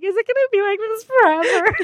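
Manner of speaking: high, pleading question; despairing wail. Retold or imitated. Exhausted new mom wondering if the chaos ever ends, high and pleading. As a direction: ask it like there's no way out. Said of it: "I was like".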